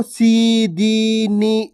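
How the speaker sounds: सी, दी, नी each with a long held ee; wrongly long सी, दी, नी, held out; drawn-out long vowel. Shown as a demonstration. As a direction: stretch the vowels in सी, दी, नी. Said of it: "इस प्रकार से नहीं पढ़ना".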